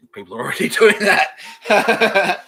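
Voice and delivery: breaking into laughter mid-line, high and loud; laughing. Retold or imitated. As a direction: laugh out the punchline. Gaia joke, laughing through.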